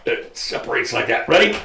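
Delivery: ordinary talk, nothing marked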